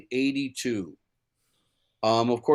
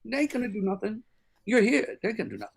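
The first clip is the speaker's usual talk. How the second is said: higher, quick lightly voiced jazzman; 7 semitones higher, faster than usual. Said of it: "He goes".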